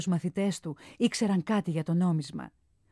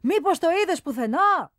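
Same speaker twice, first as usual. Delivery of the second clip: high, loud question; shouted question; 9 semitones higher, 6 dB louder than usual. A narrator's reading. The boy shouts up to Phidias, high and loud. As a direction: call it up to him. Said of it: "φώναξε ο αρχαιούλης".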